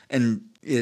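usual speaking voice

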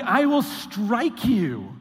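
slow, deliberate threat; mock menace. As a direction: spell out the violence slowly. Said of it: "It's like".

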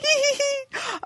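high squealing giggle; giddy high-pitched "eee" of delight; excited fangirl squeal. Acted. Squeal continues from fan's email.

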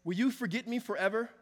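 psalm read at full preaching force; impassioned reading. Acted. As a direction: read the lament out like a plea. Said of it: "he says".

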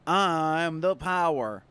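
slow, grand self-important boast; smug. Pretend. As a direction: play the big shot. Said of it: "that's why I said it like that".

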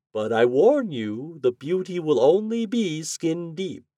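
high, slow wizard voice; drawn-out theatrical warning. A narrator's reading. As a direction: warn grandly, climbing very high on the second half. Wizard warns the glassblower.

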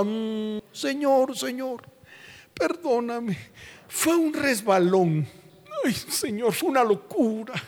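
soft, high, fake whimpering plea to the Lord; mock tearful begging prayer; crocodile tears. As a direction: whine for forgiveness with fake tears. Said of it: "Con lágrimas de cocodrilo".

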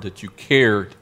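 ordinary talk, nothing marked

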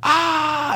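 sudden high yelp of pain and shock; a short high-pitched retold scream; retold yell. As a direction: let out a sharp high cry. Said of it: "she starts to yell".

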